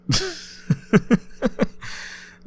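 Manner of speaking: a long breathy giggle that climbs high; giggle, caught being greedy; laugh. Acted. Caught trying to take the roommate's ice cream, high giggle.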